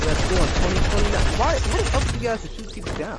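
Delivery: loud, high frustrated outburst; raging gamer. Real, not acted. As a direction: blow up at the game. Angry at getting shot down.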